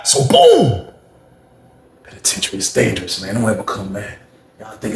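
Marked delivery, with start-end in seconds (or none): 0.0-0.9 high, drawn-out exclamation; big high-pitched boom; storyteller's boom. Real, not acted. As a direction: punch it as a story turn. Storyteller's dramatic punctuation.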